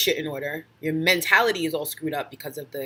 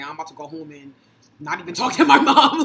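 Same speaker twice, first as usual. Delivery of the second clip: mock refusal; 10 dB louder than usual. Joking about what her own friends said, laughing mock refusal. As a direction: build to a loud laughing punchline. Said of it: "that's what we used to say".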